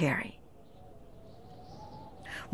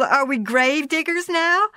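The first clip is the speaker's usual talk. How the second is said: amused; 6 semitones higher than usual. Acted. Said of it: "Laura chuckled".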